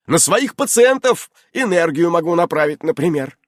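loud, high self-justification; offended Innokenty. A narrator's reading. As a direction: insist on your abilities with wounded pride.

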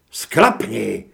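loud snarled Sklapni; a furious roar at the captive; roaring shout. Acted. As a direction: bellow it, spitting with rage. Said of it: "zařval".

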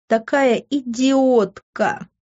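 «идиотка» stretched out syllable by syllable. A narrator's reading. Daughter berating herself to mum, «идиотка» spelled out syllable by syllable. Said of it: "по слогам произнесла я последнее слово".

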